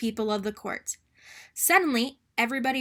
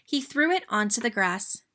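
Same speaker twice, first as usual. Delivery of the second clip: plain reading voice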